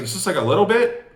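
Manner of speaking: pitched well up, making it sound tiny; minimizing. Shown as a demonstration. Salesman to homeowners, shrinking the price gap, high and light. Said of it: "Hold your hand up".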